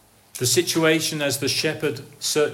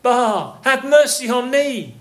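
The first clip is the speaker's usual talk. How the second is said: raised, pleading cry; crying out to God; 7 semitones higher, 5 dB louder, slower than usual. Acted. A sinner crying back to the Lord, raised plea. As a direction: call out for mercy, higher and louder. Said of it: "you can only say this".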